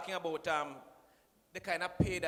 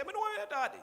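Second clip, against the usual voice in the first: much higher squeaky mock retort; mock reply; 4 dB louder than usual. Speaker imitating the minister's answer, high mock retort. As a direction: pipe it up high like a cheeky answer. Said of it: "And you say".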